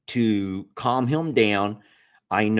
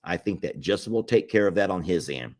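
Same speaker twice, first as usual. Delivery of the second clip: usual speaking voice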